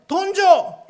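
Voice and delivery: put-on high, loud child's demand; acted child calling out to mother; demanding little kid. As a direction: play a kid pestering mom for money. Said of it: "한 꼬마가 엄마한테".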